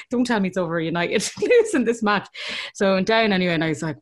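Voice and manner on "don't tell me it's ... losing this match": ordinary talk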